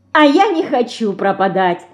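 usual speaking voice

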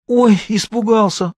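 high, drawn-out mock fright; mocking smirk. A narrator's reading. Vitka taunts his accusers at the meeting, smirking and mocking. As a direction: feign fear with a slow, high-pitched sneer. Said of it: "ухмыльнулся Витька".

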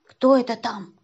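loud, gruff-adult challenge; startled demand. A narrator's reading. Man hears voice below, startled and loud. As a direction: call down under the seat.

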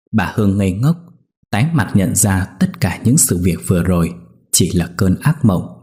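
usual speaking voice

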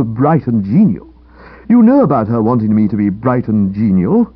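emphatic, exasperated complaint; worked-up character voice, pouring out a grievance; aggrieved young man. Acted. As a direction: protest the whole sorry business with feeling. Aggrieved character recounts failed lunch.

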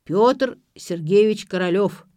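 slow, loud, ceremonious announcement; solemn proclamation. A narrator's reading. Drunkard announces his own name, solemnly. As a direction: proclaim the full name grandly. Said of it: "торжественно заявил алкоголик".

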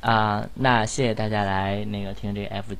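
normal talking voice

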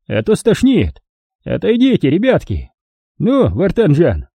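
put-on high, cheerful cruel old man. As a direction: play a folksy sadistic old prospector. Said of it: "предупредил Лунжаков".

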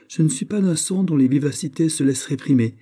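proud refusal to be checked; hauteur. A narrator's reading. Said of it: "dit Raoul avec hauteur". (réplique hautaine, ton plus aigu)

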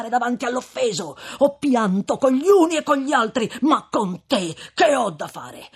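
loud, high scolding shout; stern. Acted. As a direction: rebuke with mounting anger. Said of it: "con voce non meno severa". (voce severa e alta del frate)

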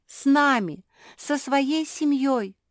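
high, loud insistent cry; group shout. A narrator's reading. Family begs Куки to stay with them, shouting. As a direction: plead at the top of the voice. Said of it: "закричали все".

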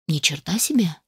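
stunned breathy whisper. Acted. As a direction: whisper it in disbelief. Stunned muttered exclamation.

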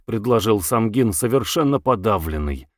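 plain reading voice

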